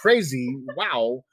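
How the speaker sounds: halting impression with jumps in pitch; Christopher Walken. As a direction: imitate a famous actor's odd, broken-up phrasing. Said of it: "doing a lot of walking impressions".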